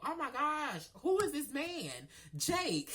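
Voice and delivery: squealing about Jake, then bursting into laughter; giddy gushing. Real, not acted. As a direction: swoon out loud over him. Gushing over the man.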